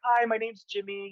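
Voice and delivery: high, put-on polite hello; rehearsed chirpy introduction. Job seeker introducing himself to salons, mock perky. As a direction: imitate your own perky door-to-door pitch.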